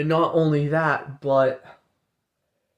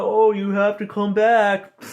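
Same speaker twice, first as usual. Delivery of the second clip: mock luring voice; 6 semitones higher, 3 dB louder than usual. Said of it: "and I'm like".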